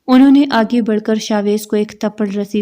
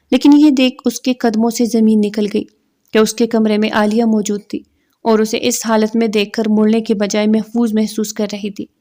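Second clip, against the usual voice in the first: ordinary delivery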